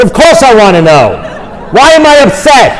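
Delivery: loud, high-pitched comic outburst; acted furious demand; exasperated shouting. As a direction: explode with impatience, building to a yell. Acts exploding at calm sponsor.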